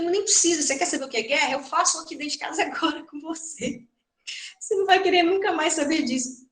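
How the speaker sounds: a mother's alarmed, half-acted telling-off; mock stern outburst. Mother scolds son about war. (mãe repreendendo o filho, em tom alto e encenado)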